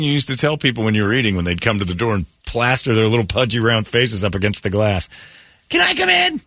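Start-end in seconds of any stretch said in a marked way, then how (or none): 5.7-6.4 put-on kid voice. Kid at the door.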